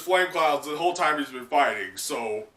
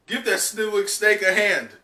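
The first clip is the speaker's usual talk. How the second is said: higher, louder teasing call; cocky Luffy; 7 semitones higher, 5 dB louder than usual. Acted. Luffy teasing Momo in battle, loud and mocking. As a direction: taunt the snake playfully. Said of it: "and Luffy goes".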